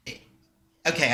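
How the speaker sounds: ordinary delivery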